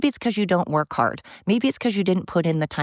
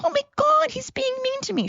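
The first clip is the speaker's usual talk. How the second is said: mocking, exaggerated high-pitched complaint; mock whiner. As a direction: imitate a pathetic whiner in a squeaky falsetto. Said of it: "And you don't get to go".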